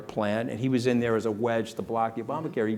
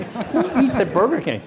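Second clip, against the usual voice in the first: laughing; 12 semitones higher, 6 dB louder than usual. Real, not acted. Laughing aside, high pitch.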